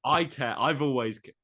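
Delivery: protest, raising voice; loud. Real, not acted. Heated playful argument.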